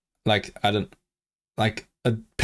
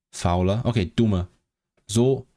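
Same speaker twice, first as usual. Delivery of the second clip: normal talking voice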